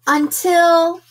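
ordinary delivery, nothing marked

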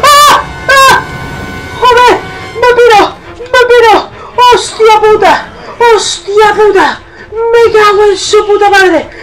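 high, loud terrified yelling; panicked screaming. Real, not acted. Player chased through the dark, screaming in terror. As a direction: shriek and curse while fleeing.

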